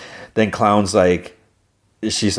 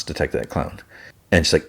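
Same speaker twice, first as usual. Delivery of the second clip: usual speaking voice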